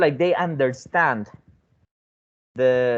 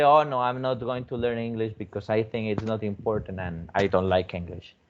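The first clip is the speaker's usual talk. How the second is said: reluctant student; 6 semitones lower, 4 dB quieter than usual. Retold or imitated. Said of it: "some of them will say".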